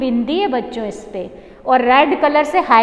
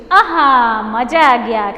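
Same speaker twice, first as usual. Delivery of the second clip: savouring delight; 2 semitones lower, 4 dB louder, slower than usual. Acted. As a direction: act out relishing a tasty treat. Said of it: "तो आप बोलते हो खा के". (स्वाद लेकर खुशी से बोलना)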